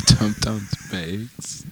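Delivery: soft slow fond echo; tender. Real, not acted. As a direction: say it softly, touched.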